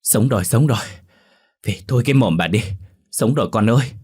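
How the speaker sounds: high, loud cry of relief; overjoyed relief; joyful cry. A narrator's reading. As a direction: shout for joy that she lives. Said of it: "Bà Dung sung sướng".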